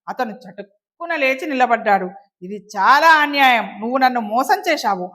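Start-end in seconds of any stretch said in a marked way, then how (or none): none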